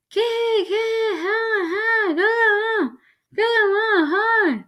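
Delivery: high muffled wailing; mouth stuck mumble. A narrator's reading. Frozen-lipped boy begging his brother, muffled wail. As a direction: wail words you can't form with stuck lips. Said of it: "sounding like he had something in his mouth".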